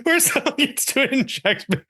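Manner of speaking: high voice breaking up with laughter; laughing hard. Real, not acted. Joking about a prize to inject them, laughing hard. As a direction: squeeze the words out through real giggles. Cracking up at own joke.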